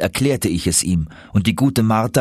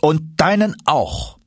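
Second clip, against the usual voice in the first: higher, slow deliberate retort; stern professor; 8 semitones higher, slower than usual. Acted. Professor adding his own trunk, slow pointed retort. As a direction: drive it home slowly.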